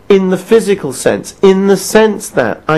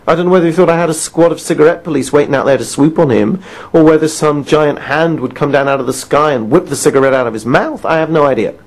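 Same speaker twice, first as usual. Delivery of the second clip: normal talking voice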